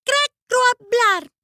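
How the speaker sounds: crow-speak; croak. Acted. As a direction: caw it out like a crow. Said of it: "graznó Annie".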